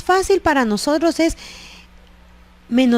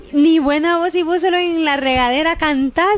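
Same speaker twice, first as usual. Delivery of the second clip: higher-pitched mock scorn; belittling; 5 semitones higher than usual. Relative mocking someone chosen to sing praises, belittling. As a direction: put the singer down in a raised pitch.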